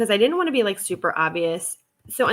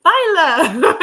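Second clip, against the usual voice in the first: high, loud shout of song words with a laugh; yelling Spanish lyrics; 10 semitones higher, 9 dB louder, much slower than usual. Retold or imitated. Friend yelling song lyrics at the club, laughing shout. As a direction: yell it out like on the dance floor. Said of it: "she'll be like".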